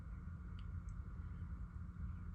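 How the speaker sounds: soft, drawn-out breath out; long sigh. Real, not acted. Pausing in the story of being upset, long soft sigh. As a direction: let out a long quiet sigh.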